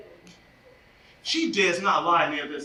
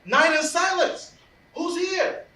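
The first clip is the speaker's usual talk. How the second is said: startled call; 8 semitones higher than usual. Acted. Puck discovers sleepers in forest.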